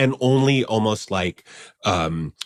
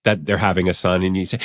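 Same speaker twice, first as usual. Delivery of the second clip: normal talking voice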